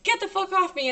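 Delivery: high-pitched mock screaming, pushing someone away; high, urgent retold cry. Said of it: "I'm fucking screaming".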